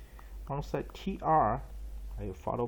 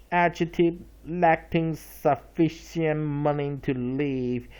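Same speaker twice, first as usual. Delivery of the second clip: slow, careful reading of an entry for learners; dictionary read-aloud; 6 dB louder than usual. Shown as a demonstration. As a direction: read the entry slowly and clearly to the class. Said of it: "reading dictionary entry aloud".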